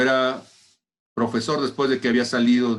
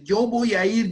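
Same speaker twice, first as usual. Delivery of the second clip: "yo voy a ir" much higher, the yo hit hardest; desperate shout; 11 semitones higher, 6 dB louder than usual. Retold or imitated. Son crying out to his mother, higher desperate vow. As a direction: scream "yo" high and loud in the repeat. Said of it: "yo estaba gritando desesperado".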